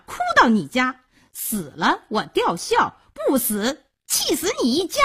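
high-pitched, shrewish tirade; high, indignant scolding, rising to spite; angry cursing. Acted. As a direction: berate a departing lover with threats. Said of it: "萧娥骂道".

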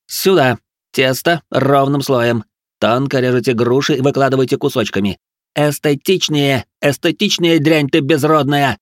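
irate chef building to abuse; angry ordering. A narrator's reading. Chef berating pupils over their work.

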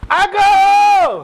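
high, loud shouted call; full shouted call-and-response lead. Shown as a demonstration. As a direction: belt the call out to the crowd. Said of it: "So when I shout my".

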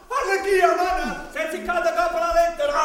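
loud, fast yelling; shout. Acted. Ciceronella yelling at Arlecchino about the stuck letter, loud. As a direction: yell at him in a hurry.

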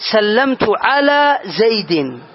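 "سلمت على زيد" very slow, the i at the end of زيدِ clearly sounded; slow case demo. Shown as a demonstration. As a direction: draw the sentence out so the kasra on "زيد" is heard. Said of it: "أحدثها العامل وهو على". (نطق بطيء مع إظهار كسرة "زيد")

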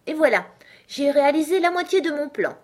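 high, loud self-congratulation; pleased exclamation. A narrator's reading. Alice talking to herself after regaining her size, triumphant.

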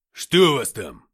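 loud gruff question; growled question. A narrator's reading. Kiriyenko growls at the fighter. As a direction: snap it out. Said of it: "рыкнул Кириенко".

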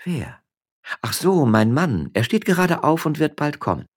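high, mock-casual reply; feigned innocence. Wife teasing her husband about the miller, mock innocent. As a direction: play dumb sweetly.

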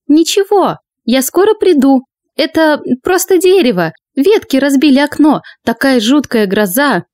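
nervous lady of the house; high, tense voice covering fear; high anxious woman. Acted. Maria's voice held.

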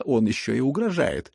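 ordinary delivery

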